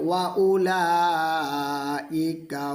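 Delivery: long melodic chanted phrase; drawn-out tilawat; slow Quran recitation. Shown as a demonstration. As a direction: recite the full phrase slowly in tajweed. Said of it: "এরকমভাবে বানান একটা একটা করে অক্ষর উচ্চারণ".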